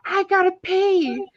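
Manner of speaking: put-on high, slow, loud little-kid voice; child's loud announcement. Mom mimics daughter standing in her seat, announcing loudly. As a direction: announce it to everyone like a toddler. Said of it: "She just announced things like".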